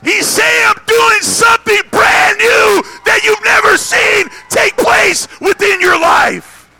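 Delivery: high, loud shouted proclamation; roaring, high-pitched sermon climax; full-force preaching. Real, not acted. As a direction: shout it out to the whole congregation.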